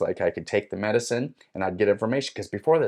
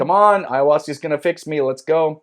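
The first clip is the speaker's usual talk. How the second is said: loud, higher, impatient cheer; eager rallying shout; 7 semitones higher, 8 dB louder than usual. Retold or imitated. Seeker first up for another pour, excited. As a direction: urge it on, raised and excited.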